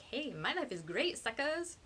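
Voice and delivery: slow, sing-out taunt, rising high at the end; mock gloating. A narrator's reading. Narrator imagines gloating to friends, mock boastful. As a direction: brag cheerfully to the losers.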